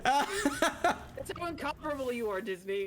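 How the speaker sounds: laughing. Real, not acted. Mock praise for Disney.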